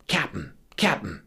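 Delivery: urgent stage whisper; little Dutchman. A narrator's reading. Little Dutchman tugging captain's coat, urgent whisper. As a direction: hiss it for the captain's attention. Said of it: "the little Dutchman looks up and says".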